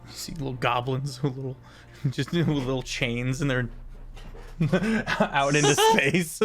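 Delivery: usual speaking voice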